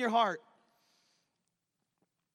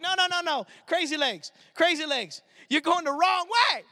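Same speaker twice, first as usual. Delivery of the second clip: mock crowd shouting; 6 semitones higher, 4 dB louder than usual. Crowd shouting he's wrong way.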